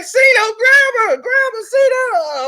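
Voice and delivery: high, loud panicked shouts for help; frantic yelling. Retold or imitated. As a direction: yell for Cino to grab her mid-fight. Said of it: "And they like".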